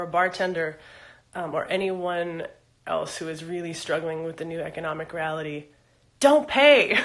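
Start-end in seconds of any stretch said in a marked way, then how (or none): 6.2-7.0 loud, high burst; sudden raised-voice insistence; emphatic exclamation. Real, not acted. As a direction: call it out as a cheerful order, about to laugh. Emphatic aside to struggling viewers.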